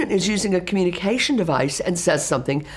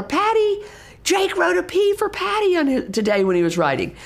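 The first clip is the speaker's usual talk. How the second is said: excited calling out; 11 semitones higher than usual. Shown as a demonstration. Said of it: "We gotta tell her".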